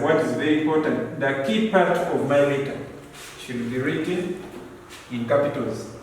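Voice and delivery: ordinary talk, nothing marked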